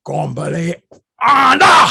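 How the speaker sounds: rising to a loud, high bellowed order; parade-ground command building to a yell; officer ordering troops to attention, loud shout; drill command shout. Retold or imitated.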